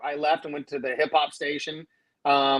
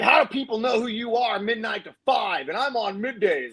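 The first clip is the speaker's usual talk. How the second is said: higher-pitched mock complaint; indignant boss; 6 semitones higher than usual. Program director complaining to the night guy, imitated indignation. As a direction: mimic a put-out boss.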